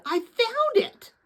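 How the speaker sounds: high, slow, thrilled announcement; excited rush. A narrator's reading. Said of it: "He spoke in an excited rush".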